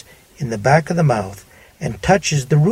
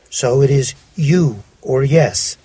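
ordinary talk, nothing marked

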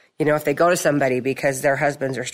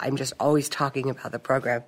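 usual speaking voice

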